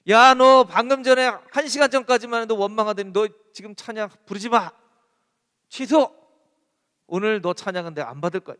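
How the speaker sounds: loud, stern rebuke to a fickle worshipper; God loudly rebuking a fickle worshipper; stern scolding. Pretend. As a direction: scold the child who just grumbled, hard.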